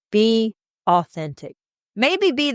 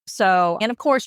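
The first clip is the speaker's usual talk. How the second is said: ordinary talk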